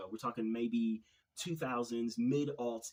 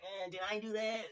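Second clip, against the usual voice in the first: high, nerdy sitcom-geek voice; Steve Urkel; 9 semitones higher than usual. Retold or imitated. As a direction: squeak it out like a nerdy kid. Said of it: "in his little nerdy voice".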